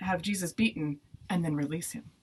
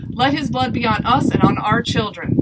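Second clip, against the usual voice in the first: very loud, higher-pitched cry; crowd shouting; 5 semitones higher, 11 dB louder than usual. A narrator's reading. Crowd takes the blame from Pilate, shouting.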